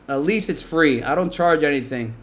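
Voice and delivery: ordinary talk, nothing marked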